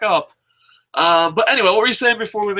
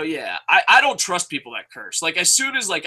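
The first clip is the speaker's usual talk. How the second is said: ordinary talk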